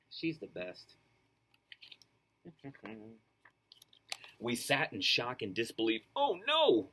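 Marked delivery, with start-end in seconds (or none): 6.2-6.9 moaning. Acted. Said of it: "we moaned".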